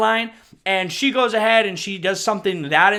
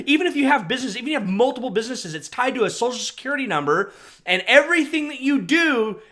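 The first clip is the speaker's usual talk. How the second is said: ordinary delivery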